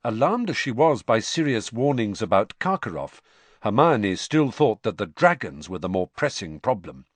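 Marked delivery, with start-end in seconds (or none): none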